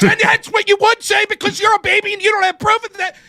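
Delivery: very high mock shouting; exaggerated high-pitched yelling over someone; mocking loud interrupter. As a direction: burst in as a bickering opponent, imitated. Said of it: "you sound like this when you're talking over me".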